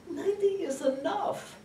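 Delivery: put-on high, drawn old mother voice; elderly mother's pronouncement. Mother revealing her book title to her daughter, high-voiced. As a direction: announce it in a high old lady's voice. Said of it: "She said".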